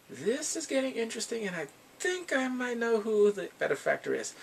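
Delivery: high, eager excited voice; thrilled to be onto the mystery; excited realization. Retold or imitated. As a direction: pitch up with growing intrigue. Her own excited reaction while watching.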